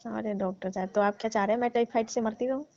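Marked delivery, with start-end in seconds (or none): none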